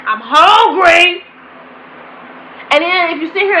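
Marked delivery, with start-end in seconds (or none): 0.1-1.2 loud, drawn-out mock whine for food; imitating a child's slow, loud complaint; voicing hungry child. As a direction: stretch it out high and loud like a hungry kid. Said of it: "such as".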